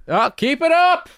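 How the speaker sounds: put-on cranky old man egging him on; sarcastic old neighbor. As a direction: jeer it up through the floor. Neighbor voiced mocking from below.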